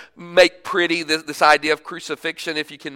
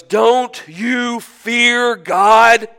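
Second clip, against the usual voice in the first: very slow, strained, louder and higher; gasping rebuke; 6 semitones higher, 8 dB louder, much slower than usual. Acted. Crucified criminal rebuking the other, gasping for breath. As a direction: force each word out between breaths. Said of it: "trying to get a breath".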